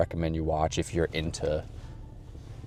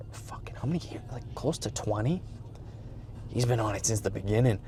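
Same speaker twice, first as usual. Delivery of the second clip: whispered aside; 10 dB quieter than usual. Real, not acted. Aside on years online.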